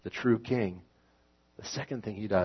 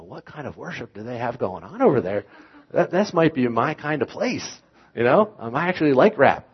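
eager, much higher and louder, thrilled at the idea; excited; 6 semitones higher, 10 dB louder than usual. Retold or imitated. Pastor retelling his excited reaction to rap worship, eager. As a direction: gush like a kid hearing his favourite music.

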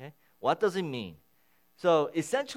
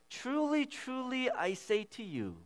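"Truly, truly" pitched much higher and punched; emphatic repetition; 10 semitones higher than usual. Shown as a demonstration. Pastor showing how Jesus repeats the phrase, emphatic high "Truly, truly". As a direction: ring out each "Truly" high and strong. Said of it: "the way he says it".

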